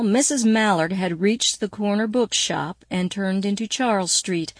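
ordinary delivery

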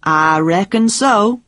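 slow, drawn-out bad Texan drawl; dreadful Texan accent. A narrator's reading. Pete answering Jane's question, dreadful Texan accent. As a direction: put on a hammy Texan accent. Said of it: "in his dreadful Texan accent".

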